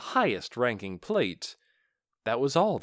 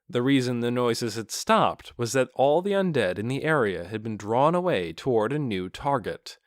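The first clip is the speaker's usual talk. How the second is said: ordinary delivery, nothing marked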